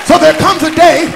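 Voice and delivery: loud, sung-out preaching over the organ; full-force preaching. Acted. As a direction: preach it out with fire to the church.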